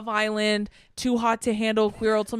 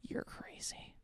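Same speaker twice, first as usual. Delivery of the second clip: hushed, voiceless aside; whispered disbelief; 22 dB quieter, slower than usual. Real, not acted. Podcaster judging couples who film therapy, whispered disbelief. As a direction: whisper it as if appalled.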